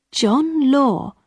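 ordinary delivery, nothing marked

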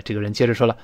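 ordinary talk, nothing marked

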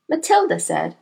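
plain reading voice

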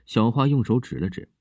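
ordinary delivery, nothing marked

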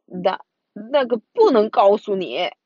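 muffled, covering the mouth; hand over mouth. Acted. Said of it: "捂着嘴说".

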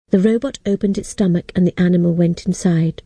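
plain reading voice

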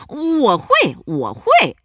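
eager repeated assurance; hasty, eager. A narrator's reading. Monster assuring the pirate captain it cooks, hasty and eager. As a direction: insist anxiously that you can. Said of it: "丑妖怪急忙点头说".

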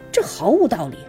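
ordinary talk

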